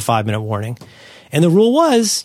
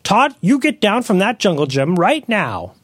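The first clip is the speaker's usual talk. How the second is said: hammed-up scolding parent, pitched way up; exasperated parent; 7 semitones higher, much slower than usual. Parent scolding Todd off the jungle gym, high mock yell. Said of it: "you hear in the parent's voice".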